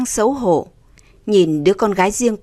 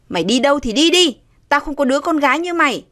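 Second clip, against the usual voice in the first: fast, higher-pitched rejection; angry dismissal; 6 semitones higher, faster than usual. A narrator's reading. Father disowning his daughter, angry. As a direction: cast her out without pausing.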